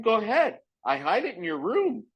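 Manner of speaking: high teasing comeback; cocky dare. Retold or imitated. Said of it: "I'm like".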